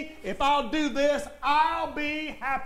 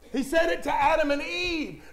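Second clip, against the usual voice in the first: ordinary talk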